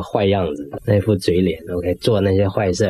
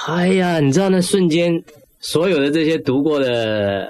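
thrilled, remembering the moment it all clicked; excited realisation; 6 semitones higher than usual. Real, not acted. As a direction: voice jumps up high in amazement.

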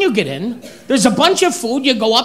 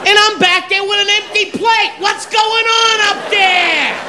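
exasperated yelling; 6 semitones higher than usual. Acted. Frustrated buffet rant, yelling.

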